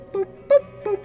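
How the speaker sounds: ordinary talk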